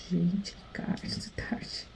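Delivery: real hushed whisper, barely voiced. Real, not acted. Whispering so her husband in the living room isn't disturbed. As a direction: drop to a breathy whisper so nobody hears. Husband nearby, late at night.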